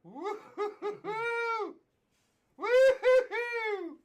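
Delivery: excited whooping. Real, not acted. Card breaker celebrating a huge pull, excited whooping.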